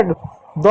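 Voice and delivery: "द" with tongue on the teeth; soft dental d. Shown as a demonstration. Teacher showing a sound of letter D, dental. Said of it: "द भी पढ़ते हैं".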